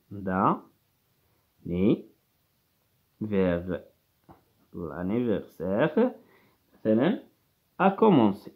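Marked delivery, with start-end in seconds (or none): 0.1-6.1 d'anniversaire broken into syllables, quieter and lower; slow syllabified d'anniversaire for pupils. Shown as a demonstration. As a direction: spell out d'anniversaire one syllable at a time. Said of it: "لا فيت دانيفرسير".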